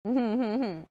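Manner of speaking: heavy sigh. Real, not acted. Tired aside after interruption.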